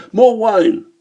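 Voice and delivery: high, haughty order; arrogant. Acted. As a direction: demand it arrogantly. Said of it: "he calls out in the most arrogant manner".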